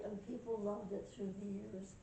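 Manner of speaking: ordinary delivery, nothing marked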